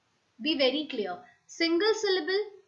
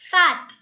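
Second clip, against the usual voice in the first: Fat called out loudly; loud example word; 4 semitones higher, 12 dB louder than usual. Shown as a demonstration. Teacher calling out example words to the class, loud. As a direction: punch Fat like a board example. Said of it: "teacher reads example word".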